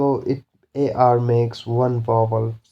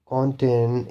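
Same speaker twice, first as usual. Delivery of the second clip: ordinary talk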